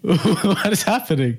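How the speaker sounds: high, laughing what is happening; laughing disbelief. Real, not acted. Friend laughing in disbelief at the tackling dad. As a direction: laugh through the question in disbelief.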